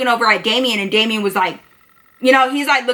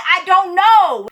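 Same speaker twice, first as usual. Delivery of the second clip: higher, drawn-out protest of cluelessness; exasperated retort; 6 semitones higher, 3 dB louder, much slower than usual. Retold or imitated. Narrator defends herself to her suspicious friend, exasperated. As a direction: stretch it out, slow and higher, exasperated. Retold confrontation, pleading innocence.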